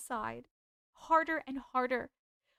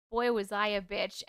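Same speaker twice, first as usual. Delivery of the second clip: ordinary talk, nothing marked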